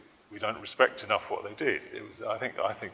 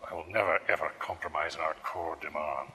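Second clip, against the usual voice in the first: put-on Scottish accent; solemn mock pledge in a Scottish accent; Nicola Sturgeon; 2 semitones lower than usual. SNP leader's absurd core demand, Scottish accent. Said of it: "Nicola Sturgeon".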